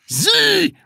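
very high, loud single word; agitated correction. Acted. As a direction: snap the correction out, worked up. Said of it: "verbesserte Herr Groll erregt".

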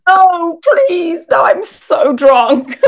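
high, loud, slow mock wail of embarrassment; mock mortified drunk. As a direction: squeal it out like a mortified drunk watching herself. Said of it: "I was like".

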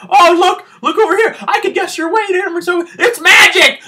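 coaching staff mocked as a carnival barker, hammed-up. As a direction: lure the rubes like a fairground barker. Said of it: "They're like".

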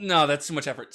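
ordinary talk, nothing marked

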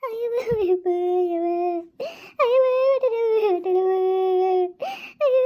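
high, crying moans; acted labor pain. Woman in labor, moaning through her pains. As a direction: wail with each pain.

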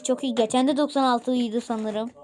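ordinary talk, nothing marked

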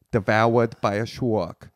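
slow, drawn-out South African vowels; South African childlike accent. Shown as a demonstration. Comedian shows how the woman sounded, slow South African accent like a small child. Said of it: "No, like Charlie bit my finger".

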